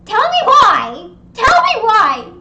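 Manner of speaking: high, very loud, drawn-out demand; exasperated outcry. Real, not acted. Storyteller baffled by the neighbor's choice, exasperated outcry. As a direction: belt out your disbelief.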